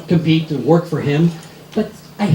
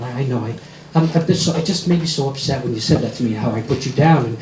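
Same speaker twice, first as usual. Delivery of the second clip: mock tearful apology; 8 semitones lower than usual. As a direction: plead sheepishly.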